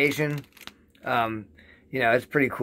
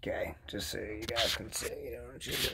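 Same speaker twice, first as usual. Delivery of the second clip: soft whisper to viewers; whispered aside; 2 semitones lower, 15 dB quieter than usual. Real, not acted. Showing viewers the scale, whispering.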